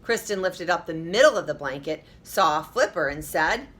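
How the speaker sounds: usual speaking voice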